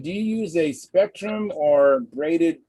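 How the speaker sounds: ordinary talk, nothing marked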